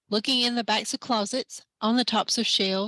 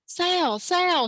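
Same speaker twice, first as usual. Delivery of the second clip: higher repeated call into the shop; shouted; 8 semitones higher than usual. Acted. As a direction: shout for him across the room. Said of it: "shouted Wanda Lynn".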